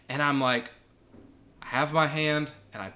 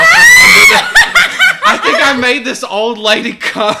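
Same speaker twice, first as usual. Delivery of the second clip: much higher, loud, slow shout through laughter; laughing shout; 18 dB louder, much slower than usual. Real, not acted. Telling friends the punchline of his story, laughing shout. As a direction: blurt the punchline while cracking up.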